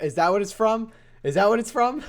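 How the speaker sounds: loud, high-pitched excited question; excited realization. Real, not acted. Streamer realizing where the chat meme is from, excited. Suddenly recognizing the meme's source.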